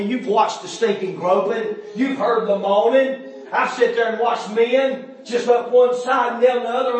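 usual speaking voice

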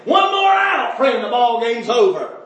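high, loud shout of the final out; excited shout. Acted. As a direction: call it like the game's won. Preacher acting out final out.

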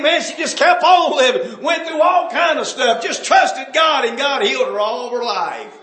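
high, loud pulpit testimony; full-force preaching. Acted. Preacher testifying how God healed an old saint, full-force preaching. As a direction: preach it loud, pitched high, with long drawn key words.

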